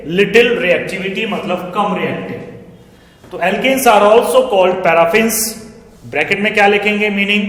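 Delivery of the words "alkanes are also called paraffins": slow, spaced words for students to copy; slow dictation. Shown as a demonstration. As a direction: read the line out slowly for notes. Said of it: "लिख लीजिएगा". (धीमा dictation)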